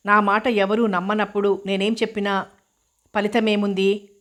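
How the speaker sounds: ordinary delivery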